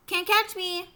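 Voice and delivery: high, loud teasing taunt; singsong it like a runaway tot; gleeful small-child holler; hollering toddler. A narrator's reading. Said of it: "he hollered".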